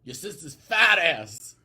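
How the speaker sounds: loud, slow mocking yell; mock fan yell. Echoing the crass fan comment, mock yell. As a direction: drag out the insult loudly, hammed up. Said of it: "That's exactly what they say".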